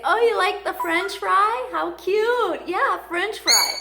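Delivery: high, bright pet-talk voice; cooing at the parrot; baby talk to the bird. Retold or imitated. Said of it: "And I say".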